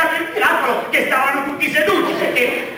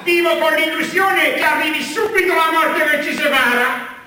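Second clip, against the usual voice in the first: usual speaking voice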